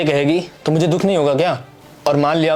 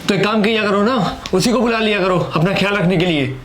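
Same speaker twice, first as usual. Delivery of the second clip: higher-pitched irritated retort; 5 semitones higher than usual. Acted. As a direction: throw it back in a huff. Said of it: "तुम चिढ़ क्यों रहे हो".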